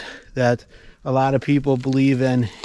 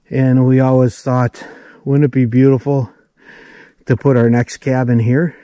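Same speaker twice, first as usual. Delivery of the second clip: winded, pushing words out loud; breathless from the steep climb; out of breath; 4 dB louder than usual. Real, not acted. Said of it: "if it sounds like I'm out of breath".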